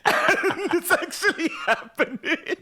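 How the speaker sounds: high-pitched, laughing through the words; helpless laughter. Real, not acted. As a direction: squeal it out through real laughter. Cracking up at the recap.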